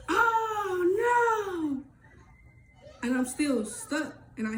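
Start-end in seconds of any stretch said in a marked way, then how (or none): 0.1-1.8 high crying shout of grief; mother's wail on hearing her son died; anguished cry. Retold or imitated. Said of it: "his mama just say".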